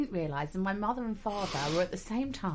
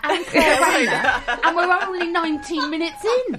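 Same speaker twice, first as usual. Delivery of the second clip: laughing while talking; words bubbling with laughter; 9 semitones higher, 9 dB louder than usual. Real, not acted. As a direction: giggle through the words. Laughing through the joke.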